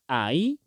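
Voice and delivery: slow "ahí" in two syllables, final í stressed. Shown as a demonstration. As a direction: say "ahí" slowly as a-Í. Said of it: "tenemos que hacer el énfasis ahí".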